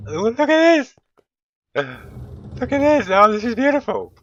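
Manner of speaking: high, loud squeal of delight; thrilled. Real, not acted. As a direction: squeal it, stretched out and overjoyed. Thrilled that the setup works.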